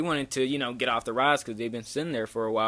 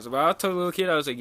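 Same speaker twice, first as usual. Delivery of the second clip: ordinary talk, nothing marked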